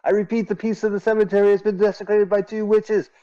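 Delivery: high, loud broadcast announcement; announcer voice. Pretend. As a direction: boom it out like a radio alert. Reads intertitle like announcement.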